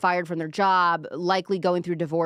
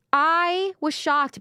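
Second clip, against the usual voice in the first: mock shock; 9 semitones higher, 4 dB louder, much slower than usual.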